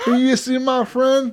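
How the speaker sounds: high, slow mock-drunk plea. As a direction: imitate a drunk pestering strangers. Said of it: "as you get more drunk".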